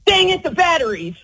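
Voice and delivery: mildly annoyed over the missing batteries; frustrated dang it. Shown as a demonstration. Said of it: "say how you want it to be said".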